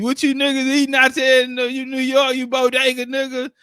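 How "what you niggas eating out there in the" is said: usual speaking voice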